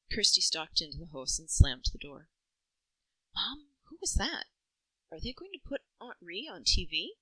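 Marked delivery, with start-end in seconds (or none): 3.4-7.1 lightly voiced small boy; child's voice. A narrator's reading. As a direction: voice a curious seven-year-old. Seven-year-old Nigel asking.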